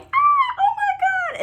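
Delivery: very high, laughing squeal of delight; squealing friends. Retold or imitated. Classmates cheering from the roadside, squealing with excitement. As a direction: shriek it like classmates spotting a friend. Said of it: "and they all were like".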